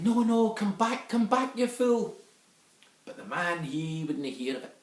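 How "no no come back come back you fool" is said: high, slow, desperate calls of come back; shouting after him. Acted. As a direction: call out after a husband walking into danger. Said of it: "the woman was shouting".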